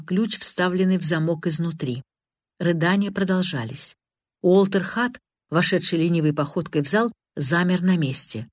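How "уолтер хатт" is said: plain reading voice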